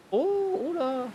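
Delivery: sudden high-pitched oh of surprise; surprised. Real, not acted. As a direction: yelp at something unexpected. Sudden reaction to game screen. (surprise soudaine, très aiguë)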